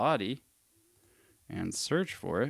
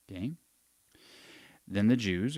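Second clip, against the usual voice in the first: ordinary talk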